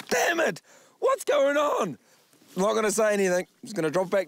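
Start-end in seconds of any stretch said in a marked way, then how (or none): 0.1-2.0 frustrated outburst. Real, not acted. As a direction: vent at losing the fish. Just lost the challenge fish.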